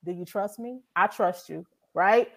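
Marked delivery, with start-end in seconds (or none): 0.1-0.8 soft voice of God. Retold or imitated. God's voice in her story.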